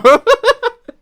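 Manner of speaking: high, loud mock cackle; hammed-up villain cackle; imitated Liv Morgan laugh. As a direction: cackle like her again, smugly. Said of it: "That's how she laughs".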